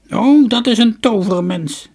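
put-on high, loud, creaky old woman's voice; shrill-pitched storybook crone, full voice. A narrator's reading. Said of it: "zei de oude vrouw".

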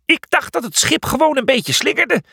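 very high, flustered character voice; high-pitched, winded excuse; puffing. A narrator's reading. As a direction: puff it out, flustered. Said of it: "pufte Felix".

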